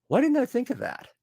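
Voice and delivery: high, sudden mock realization; as if the answer just hit you; surprised realization. Writer retelling his wife's fix, high surprised realization. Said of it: "And I went".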